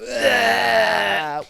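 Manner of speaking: high, loud "Ah" held long; big sustained shout into the sponsor break; loud drawn-out yell. Real, not acted. Loud break back into the show.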